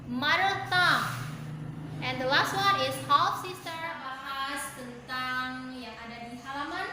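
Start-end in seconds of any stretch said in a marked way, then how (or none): 0.1-3.7 each word loud and high, with a pause after it for the class; teacher drilling vocabulary aloud; model pronunciation. Shown as a demonstration. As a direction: read each word out for students to repeat. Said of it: "I would like to read first and then you repeat after me".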